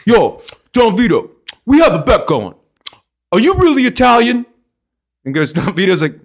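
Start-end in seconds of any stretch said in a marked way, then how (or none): 0.1-4.5 higher-pitched South Philly guy voice; South Philly Italian. Retold or imitated. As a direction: put on a swaggering Philly Italian. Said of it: "picture your typical South Philly Italian".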